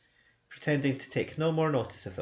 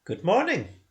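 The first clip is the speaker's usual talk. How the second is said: louder, higher, dismissive farewell; curt good morning; 8 semitones higher, 6 dB louder than usual. Acted. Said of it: "a little cross".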